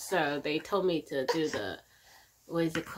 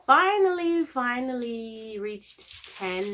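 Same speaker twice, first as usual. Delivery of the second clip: relieved emphasis; 5 semitones higher, 6 dB louder, slower than usual. Real, not acted. Relief at full dilation.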